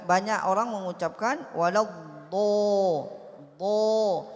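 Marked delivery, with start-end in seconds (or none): 1.6-4.3 waladdo with a long, loud rounded o; fathah mixed with dhammah. Shown as a demonstration. Teacher imitates many people's mistake, long rounded waladdo. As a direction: draw out do loudly, lips rounded. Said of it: "Itu bercampur antara harokat fathah dengan dhammah".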